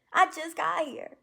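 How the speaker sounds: proud, bursting with joy; excited. Real, not acted. Celebrating her fresh start.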